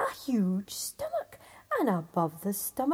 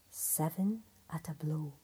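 lower, softer whispered reading; Seven at a blow, whispered low and soft; hushed reading; 6 semitones lower, 3 dB quieter than usual. Acted. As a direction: read the stitched words in a whisper. Words stitched on the belt.

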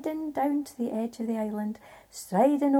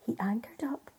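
usual speaking voice

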